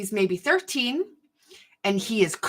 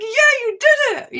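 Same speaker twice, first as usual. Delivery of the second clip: much higher, louder triumphant cry; excited cheer; 8 dB louder than usual. Retold or imitated. Boy cheering after surviving the roller coaster. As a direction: celebrate having made it.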